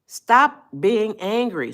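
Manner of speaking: slow, pressing command; slow down and press each word; firm slow appeal; emphatic. Real, not acted.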